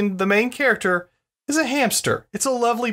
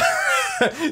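high burst of laughter. Real, not acted. Laughing at bachelor joke.

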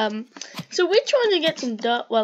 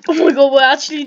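excited; 2 semitones higher, 13 dB louder, faster than usual. Real, not acted. Excited at equal damage.

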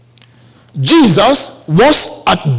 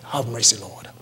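low, much softer plea; hushed plea for mercy; 11 semitones lower, 14 dB quieter than usual. Acted. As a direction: beg for mercy under the breath, head bowed. Peter bowing, pleading.

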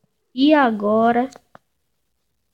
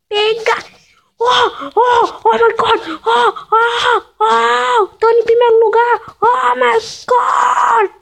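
excited screaming; 9 semitones higher, 4 dB louder, slower than usual. Real, not acted. Reaching first place in game.

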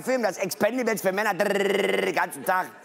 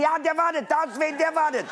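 put-on girlfriend's voice; 8 semitones higher than usual. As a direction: play her as sure she's right.

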